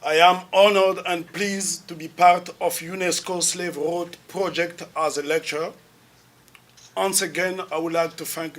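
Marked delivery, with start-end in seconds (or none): none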